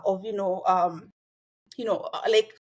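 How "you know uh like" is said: ordinary talk, nothing marked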